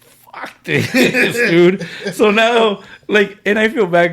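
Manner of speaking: high and loud, laughing through it; laughing outburst. Real, not acted. Laughing at his own petty reaction to friends, high and loud. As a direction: cackle at your own petty anger.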